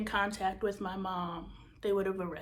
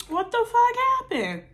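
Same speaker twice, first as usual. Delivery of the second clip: high and louder, half-shouted disbelief; loud, pitched-up outrage hit on fuck; mock shocked yell; 14 semitones higher, 8 dB louder than usual. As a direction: shriek the question at the friend. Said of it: "And I'm like".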